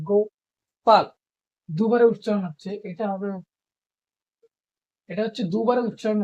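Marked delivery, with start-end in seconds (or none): 0.1-1.1 গোপাল said in two clear beats, go-pal; counting the beats in গোপাল; syllable demo. Shown as a demonstration. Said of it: "কয়বার উচ্চারণ হচ্ছে?".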